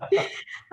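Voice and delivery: brief self-deprecating laugh; quick chuckle at your own confession; little burst of laughter; short laugh. Real, not acted.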